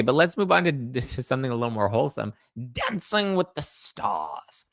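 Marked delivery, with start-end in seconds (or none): 2.7-4.6 high, slow showbiz announcement; hammed-up game show announcer. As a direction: announce it like a TV host. Announcing the next topic.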